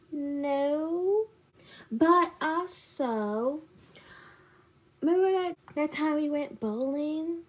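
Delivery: high, drawn-out put-on voice; imitated evasive friend. Friend brings up bowling before confessing, mock sheepish.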